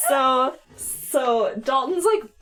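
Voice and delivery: ordinary talk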